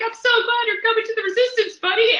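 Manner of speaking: very high, loud squeal of delight; gushing mock excitement. John Boyega hugging his co-star to lighten the set, gushing. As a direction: play an overexcited friend rushing in for a hug. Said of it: "runs up and hugs him and be like".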